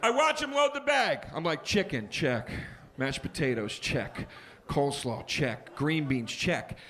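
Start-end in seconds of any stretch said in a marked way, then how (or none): 1.6-6.8 low, even item-by-item tally; methodical checklist. Acted. Customer checking the bag as it loads, methodical. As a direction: tick each one off in a low voice. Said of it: "I do the checklist".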